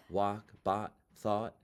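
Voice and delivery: mock-proper American accent, no Jersey "aw"; corrected standard American accent. Shown as a demonstration. Student mocking the acting teacher's correction, standard American accent. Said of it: "What am I supposed to say?".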